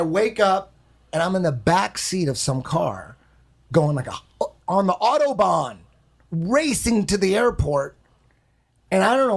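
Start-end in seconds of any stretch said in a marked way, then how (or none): none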